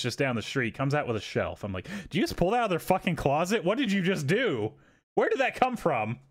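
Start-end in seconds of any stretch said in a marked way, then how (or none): 2.1-6.2 high, fast, disbelieving yell; high outraged what-the-hell; incredulous outburst. Retold or imitated. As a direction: demand answers in shocked disbelief. Outraged at worker's shelf.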